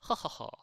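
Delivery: demonstrated laugh. Shown as a demonstration. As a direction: act out a short burst of laughter. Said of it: "I laugh, or I'm laughing".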